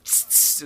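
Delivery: imitated hiss of ride brakes; short sharp bursts of air, tss tss; hissing brake noise. Rider imitating the coaster's stopping noise, hissing. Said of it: "it makes noises too".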